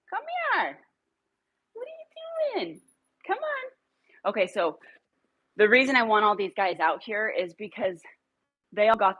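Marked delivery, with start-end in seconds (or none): 0.1-4.3 very high, sing-song dog talk. Real, not acted. Owner coaxing a dog to come in high baby talk.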